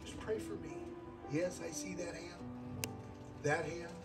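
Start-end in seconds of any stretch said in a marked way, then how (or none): none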